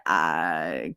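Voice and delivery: low, drawn-out "I"; reluctant low mumble of a start; retold reluctant answer. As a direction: drop the pitch and stall.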